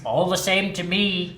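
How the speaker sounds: mild miserly grumble; grumpy Scrooge. Acted. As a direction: shrug it off coldly. Scrooge speaks.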